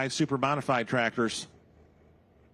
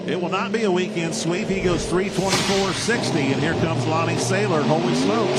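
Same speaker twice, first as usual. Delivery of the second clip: loud, rising hype call; excited announcer call; 8 semitones lower, 3 dB louder than usual. Real, not acted. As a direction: call it out over the engines. Live pull, calling out driver.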